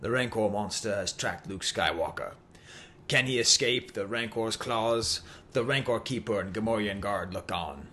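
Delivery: put-on low, measured British announcer accent; British accent that slips into Australian; attempted British accent. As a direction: try a British toy-ad narrator, drifting Australian. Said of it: "How do I kick myself in a British accent".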